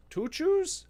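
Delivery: "Tuchus?" asked high with rising surprise; incredulous question on tuchus; startled high-pitched tuchus; puzzled tuchus. Real, not acted. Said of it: "I've never heard that word".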